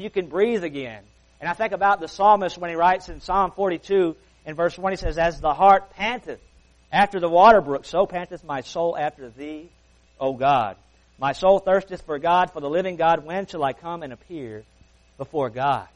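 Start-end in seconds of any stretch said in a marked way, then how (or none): 5.2-15.9 scripture reading. Acted. Reads Psalm forty-two aloud.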